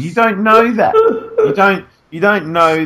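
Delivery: high-pitched exasperated objection; indignant protest. Real, not acted. Podcaster objects to co-host doubting a listener, indignant.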